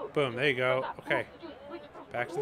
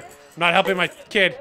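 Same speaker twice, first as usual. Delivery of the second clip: frantic shout; 3 semitones higher, 8 dB louder than usual. Real, not acted. Game chaos, shouting.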